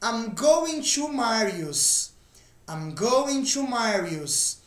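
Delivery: slow, careful English model sentence; slow model reading. Shown as a demonstration. Teacher models the sentence slowly for students. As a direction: read it slowly, twice. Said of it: "Mais um exemplo".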